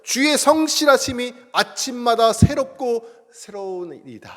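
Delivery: slow, lifted recitation of scripture; scripture reading. Acted. Pastor reciting the verse to the congregation, slow and reverent. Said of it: "성경에 이런 말씀이 있어요".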